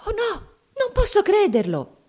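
very high, loud cry of alarm; panicked little girl, voice leaping up; frightened. A narrator's reading. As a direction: exclaim in fearful disbelief. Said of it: "esclamò Mamie impaurita".

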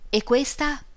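a lifted, curious question; curious exclamation. A narrator's reading. Lidia holds a photo to the lamp, surprised question. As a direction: perk up at the new photo. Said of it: "Esclamò sollevandone una".

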